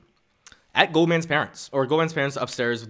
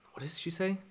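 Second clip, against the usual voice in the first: normal talking voice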